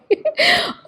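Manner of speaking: a sudden high laugh through tears; high teary laugh. Real, not acted. Thinking of her parents, a high tearful laugh. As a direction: laugh it off while welling up. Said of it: "Why am I crying?".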